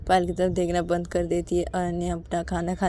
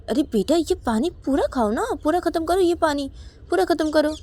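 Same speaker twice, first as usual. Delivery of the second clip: urging mother; 10 semitones higher, 5 dB louder than usual. Acted. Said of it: "Ananya की mummy बोलती कि".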